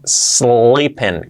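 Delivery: "sleeping" with long S gliding into L; slow "sssleeping", L clearly sounded. Shown as a demonstration. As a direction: draw out the "sl" of "sleeping". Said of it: "con la L".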